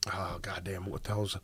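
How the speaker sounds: soft whisper while searching memory; whispered, half to self; muttered to self. Real, not acted. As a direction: hush it as you grope for the name. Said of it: "searching memory".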